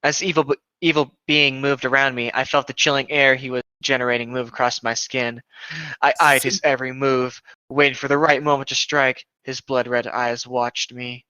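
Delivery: ordinary talk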